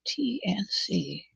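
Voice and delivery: slow hushed aside; whispered. Real, not acted. To herself while counting stitches, a slow whisper. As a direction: murmur it slowly under the breath.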